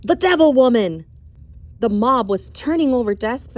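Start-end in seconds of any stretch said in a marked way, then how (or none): 0.0-1.0 loud, high shout; accusing shout. Acted. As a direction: hurl it at her like a curse. Mob leader accusing teacher.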